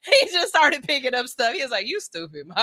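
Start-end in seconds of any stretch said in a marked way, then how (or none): 1.9-2.6 mumbled tease. Retold or imitated. Son mocking mom.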